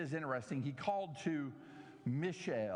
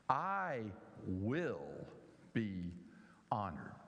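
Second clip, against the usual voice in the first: "I will be honored" with each word set apart, "will" and "honored" dropped low and slow; stress on every word; 8 semitones lower, slower than usual. Shown as a demonstration. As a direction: lay slow, heavy weight on "I", "will", "be" and "honored". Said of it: "I emphasize the words that way".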